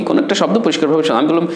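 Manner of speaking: normal talking voice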